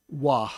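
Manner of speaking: wah with an open ah and breathed ending; ah sound. Shown as a demonstration. Teacher sounding out the second syllable, wah with an ah vowel. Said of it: "it makes the ah sound".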